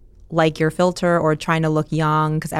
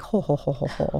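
mom's "ho ho" laugh; 6 dB quieter than usual. Retold or imitated. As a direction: imitate a careful, restrained laugh. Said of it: "she does laugh, like".

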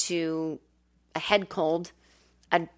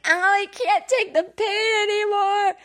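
high, slow, weepy whimper; whiny baby cry; 13 semitones higher than usual. Retold or imitated. Patient whining to the nurse about her nose, crying. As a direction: wail it like a hurt little kid. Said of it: "I was so being a baby".

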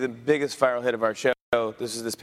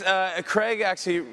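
normal talking voice

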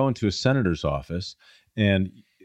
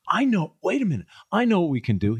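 higher, eager sudden idea; enthusiastic dentist; 10 semitones higher, 3 dB louder, faster than usual. Retold or imitated. Dentist eagerly planning for his patient's teeth, high excited voice. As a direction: mimic him struck by a bright idea. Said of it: "He was so enthusiastic".

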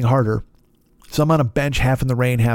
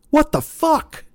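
incredulous outburst; much slower than usual. Real, not acted. Outrage at the clerk's line.

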